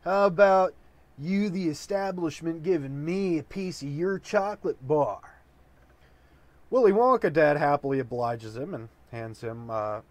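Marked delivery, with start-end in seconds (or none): 0.0-5.2 lazy laid-back hippie, pitched a bit up; a light hint of a groovy hippie. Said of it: "Walks out and says".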